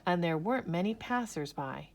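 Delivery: ordinary delivery, nothing marked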